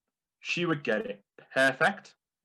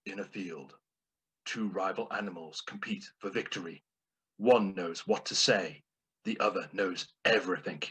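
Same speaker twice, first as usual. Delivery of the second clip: movie trailer voice; 3 semitones lower than usual. Shown as a demonstration. Narrating the cow joke as a movie trailer, hushed and dramatic. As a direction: sell it like an epic trailer. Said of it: "in the style of a movie trailer".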